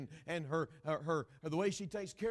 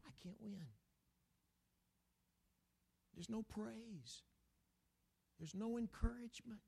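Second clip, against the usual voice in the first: put-on a hurt child giving up; dejected kid; 6 semitones higher, 6 dB quieter than usual. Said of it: "the kid says".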